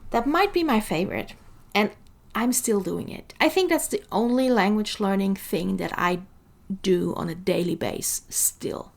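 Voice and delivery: ordinary talk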